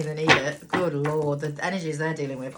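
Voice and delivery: normal talking voice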